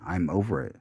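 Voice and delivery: ordinary talk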